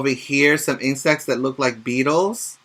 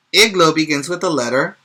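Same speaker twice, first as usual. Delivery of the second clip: ordinary delivery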